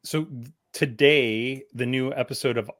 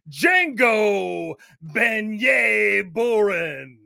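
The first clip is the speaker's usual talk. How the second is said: ringmaster; 6 semitones higher, slower than usual. Pretend. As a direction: roll out the fighter's name like a boxing announcer. Boxing ring introduction of fighter.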